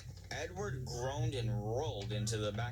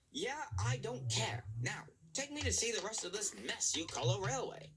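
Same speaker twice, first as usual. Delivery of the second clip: high, dismissive boss voice; dismissive controller; 11 semitones higher than usual. A narrator's reading. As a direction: brush off the engine, then order him. Controller voice held.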